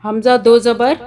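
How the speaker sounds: "हमज़ा दो ज़बर" said loudly, like a drill; loud teaching drill. Shown as a demonstration. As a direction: call it out for the students. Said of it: "teacher drills the reading". (ज़ोर से पढ़ाते हुए)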